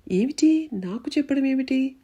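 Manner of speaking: shocked friend demanding what she means. A narrator's reading. A friend cries out high in shock. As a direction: pitch jumps high in disbelief.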